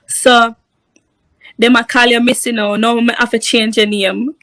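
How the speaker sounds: mom's patois, at an everyday pitch; imitating Jamaican patois. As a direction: quote mom in Jamaican Patois. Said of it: "talking patois. She be like".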